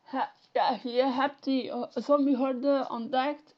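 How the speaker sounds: loud, broad Flemish dialect read. Shown as a demonstration. Showing viewers his Flemish dialect, loud and broad. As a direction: say it in thick local Flemish. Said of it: "if I should say that, I'm gonna try to do it in my dialect".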